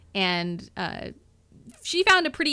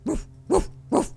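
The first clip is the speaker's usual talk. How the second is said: dog barking; 9 semitones higher, 4 dB louder than usual. Acted. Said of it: "is barking".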